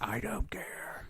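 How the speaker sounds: slow, whispery mock brush-off; imitated indifference. Retelling the customer's answer to a sales pitch, whispered and slow. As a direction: imitate him in a drawn-out, soft whisper. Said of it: "the dude's all like".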